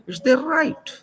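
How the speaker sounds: high, loud and insistent; emphatic insistence. Real, not acted. Insisting to the listener on their right, loud and emphatic. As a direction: drive the point home hard.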